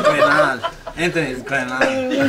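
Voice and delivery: "grenade" mockingly said "gren-ahn"; adult-sounding child. A kid mimicking the babysitter, "grenade" with mock foreign ending. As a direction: put a foreign open vowel in "grenade". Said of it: "he had this adult kind of".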